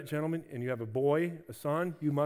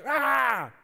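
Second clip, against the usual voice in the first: loud frustrated mock shout; frustrated roar; 8 semitones higher, 7 dB louder than usual. Caleb tearing his clothes, loud frustrated shout. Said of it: "He was like".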